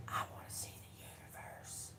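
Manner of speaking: put-on hushed, awed child's whisper; whispered wish of a small grandson; grandson's whisper. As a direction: breathe it like a little boy in wonder. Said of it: "That's how he said it, just like that".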